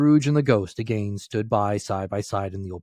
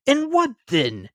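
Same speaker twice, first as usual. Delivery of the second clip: much higher, slower demand; young Scrooge presses the girl, high; pressing question; 6 dB louder, slower than usual. A narrator's reading. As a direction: push for an answer. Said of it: "young Scrooge presses".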